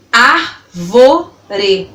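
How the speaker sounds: ÁRVORE slowly split into ár - vo - re; three separated beats on ÁRVORE; syllable by syllable. Shown as a demonstration. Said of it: "falando elas pausadamente pra você".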